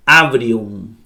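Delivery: "abrium" read slowly, A-V running into R; Greek word demonstration. Shown as a demonstration. Teacher puts the letters together for learners, slow demonstration of "abrium". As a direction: model "abrium" slowly as one joined word. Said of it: "la ro suena como R".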